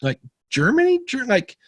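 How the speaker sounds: can't believe the call came from abroad; disbelief. Retold or imitated. Retelling his reaction to an overseas voicemail, astonished. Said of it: "I'm like".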